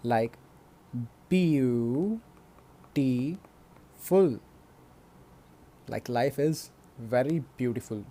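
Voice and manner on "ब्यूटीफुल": ब्यूटीफुल drawn out very slowly as ब्यू, टी, फुल; three separate syllables. Shown as a demonstration. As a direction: break ब्यूटीफुल into three long beats with gaps. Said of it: "जो तीन बार तोड़कर बोले जा सके". (ब्यूटीफुल को तीन बार तोड़कर, बहुत धीरे)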